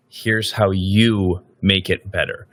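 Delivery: slow, with "you" clearly leaned on and falling; stress on YOU. Shown as a demonstration. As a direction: hit "you" so the line is about the candidate. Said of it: "You emphasize the word you".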